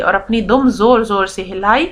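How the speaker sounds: ordinary talk, nothing marked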